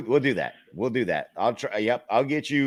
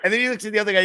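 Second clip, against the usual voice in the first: ordinary talk, nothing marked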